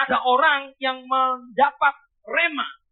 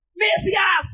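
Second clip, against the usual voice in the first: crying out; 7 semitones higher than usual. Acted. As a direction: call out Mesias like a desperate blind beggar. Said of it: "ada satu orang dapat rema berkata".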